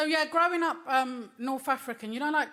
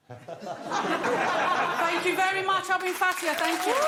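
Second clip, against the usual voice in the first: laughing thanks; 5 semitones higher, slower than usual. Real, not acted. Laughing sign-off.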